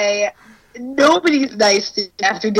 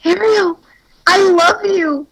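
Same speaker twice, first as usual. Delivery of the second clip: put-on lovestruck boyfriend; 8 semitones higher than usual. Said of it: "And he's like".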